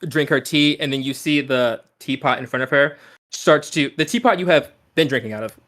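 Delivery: usual speaking voice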